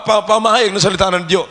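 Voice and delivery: usual speaking voice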